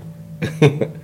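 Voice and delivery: quick airy chuckle; short breathy laugh. Real, not acted. Laughs mid affirmation.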